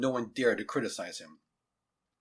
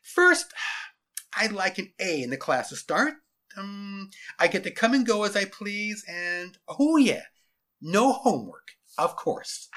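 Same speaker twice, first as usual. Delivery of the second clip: put-on high, loud cocky kid; smug boy voice, pitched up; gloating schoolboy; 8 semitones higher, 7 dB louder than usual. A narrator's reading. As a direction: reel off demands like you own the place. Gloating boy lists demands.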